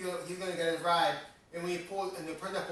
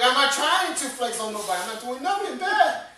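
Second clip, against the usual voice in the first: higher and louder, defending himself; indignant retold argument, raised voice; raised protest; 6 semitones higher, 6 dB louder than usual. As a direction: push back hard, voice up. Arguing back at Derek.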